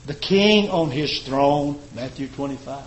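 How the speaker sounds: ordinary talk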